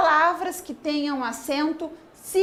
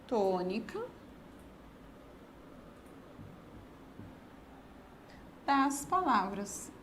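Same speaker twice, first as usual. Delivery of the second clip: soft, low, word by word with long pauses; drawn-out, quiet words left hanging; slow dictation; 4 semitones lower, 13 dB quieter than usual. Real, not acted. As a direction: dictate slowly while writing it down. Dictating while writing the answer.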